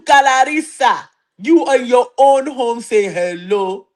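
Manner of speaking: mock-scolding African accent, drawn-out phrases. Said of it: "Let me put on my African accent".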